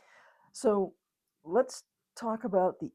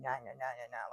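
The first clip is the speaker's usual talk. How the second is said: low, soft droning gibberish; Charlie Brown's teacher; 6 semitones lower, 10 dB quieter than usual. Acted. Spouse's scolding turned to noise, droning teacher imitation. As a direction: drone on wordlessly, low and soft. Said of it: "Charlie Brown's teacher".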